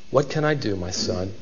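helpless, pitiful question, voice dropping. Acted. Father with no blessing left, pitiful. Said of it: "think of the pitiful inflection in his voice".

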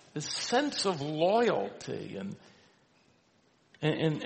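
ordinary delivery

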